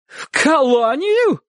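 high, long-drawn outraged exclamation; shocked cry. A narrator's reading. Aunt reacting to dog-catcher's threat, crying out. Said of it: "вскрикнула тётушка".

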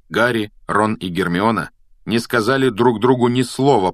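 ordinary delivery

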